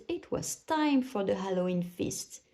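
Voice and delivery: ordinary delivery, nothing marked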